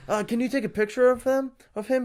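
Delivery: high put-on clerk voice; mock clerk. Retelling clerk asking photographer.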